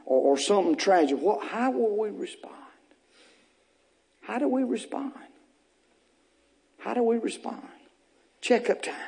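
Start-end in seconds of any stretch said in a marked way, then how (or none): 1.2-8.9 soft, quieter questioning; hushed. Real, not acted. Preacher quietly pressing church, soft question. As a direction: drop the voice, pressing gently.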